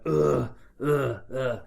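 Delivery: repeated short pained grunts; play-acted groaning while staggering in hurt; mock injured "ugh" sounds; wounded groans. Acting wounded at the medical tent.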